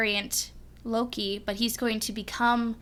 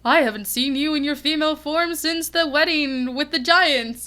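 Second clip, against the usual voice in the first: higher, louder hammed-up brotherly greeting; Thor; 5 semitones higher, 5 dB louder than usual. Fan voicing Thor greeting Lady Loki, hammed-up and loud. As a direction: play a surprised hero, pitched up and projected. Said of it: "and Thor is like".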